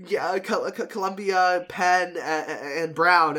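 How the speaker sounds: loud, high, stumbling over the names; a son yelling his admissions news to his mom, breathless and thrilled; excited yelling to mom. Retold or imitated. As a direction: shout the news up the stairs, tripping on words. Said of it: "yelling to my mom".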